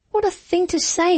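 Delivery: high, startled outburst; shocked exclamation. A narrator's reading. Suitor startled by her words, shocked high exclamation. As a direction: blurt it out, taken aback. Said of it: "he exclaimed".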